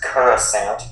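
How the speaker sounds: Coruscant as "kur-uh-SANT", short a and final t; variant pronunciation. Shown as a demonstration. Co-host listing ways they've heard it, Coruscant as "kur-uh-sant". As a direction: say Coruscant with "kur" first and "ant" last. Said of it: "pronounced so many different ways".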